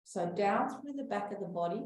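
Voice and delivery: slow, soothing breath-work guidance; guided breathing. Acted. Teacher guiding a breathing class, calm and slow. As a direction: talk the group calmly through each breath.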